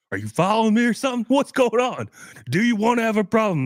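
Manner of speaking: hammed-up, squeaky fast challenge; aggressive dad. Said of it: "The guy was like".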